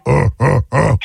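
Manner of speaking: three short mock grunts; sitcom grunt imitation. Host joking about Tool Time to the caller, imitated grunts. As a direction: grunt like a TV handyman.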